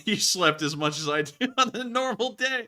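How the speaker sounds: amused disbelief. Real, not acted. One sibling teasing the other about sleep, laughing. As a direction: say it through rising laughter.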